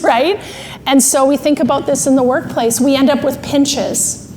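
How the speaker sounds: normal talking voice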